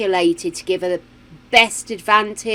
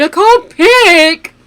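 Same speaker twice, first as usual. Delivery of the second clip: mock wail; 9 semitones higher, 10 dB louder, much slower than usual. Frozen choosing between pretty characters.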